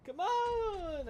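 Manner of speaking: high, loud exasperated yell; frustrated shout. Real, not acted. Frustrated at dying again.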